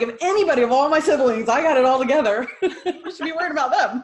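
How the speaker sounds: playful bragging through a laugh; joking brag. Acted. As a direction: boast it while breaking into laughter. Joking about her siblings.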